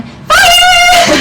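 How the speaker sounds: high, loud mock scream of alarm; yelling. Sixth graders stampeding past the counselor, yelling. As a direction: scream it out long like a stampeding kid.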